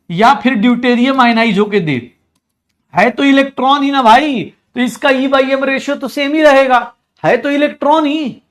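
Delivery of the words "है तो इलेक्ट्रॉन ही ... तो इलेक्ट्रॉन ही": exasperated insisting. Real, not acted.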